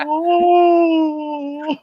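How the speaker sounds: put-on very high, drawn-out elderly wail; hammed-up old person. Teased friend playing old and dim-sighted, hammed-up elderly voice.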